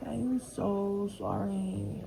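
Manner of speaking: I am so sorry, dragged out slowly; slow drawn-out apology. Retold or imitated. Apologising to the friend, dragged out slowly. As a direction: stretch out every word of the apology. Retelling her apology to him.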